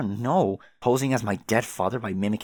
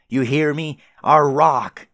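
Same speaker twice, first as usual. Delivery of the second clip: loud, higher, escalating yell; furious shout; 4 semitones higher, 9 dB louder, slower than usual. Acted. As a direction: shout it up at the sky in rage. John yelling at the sky.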